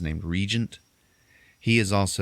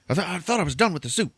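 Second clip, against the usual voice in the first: quick higher whining complaint; hammed-up whine, pitched up; high mock whine; 11 semitones higher, faster than usual. [name] refusing the Santa suit, high mock whine.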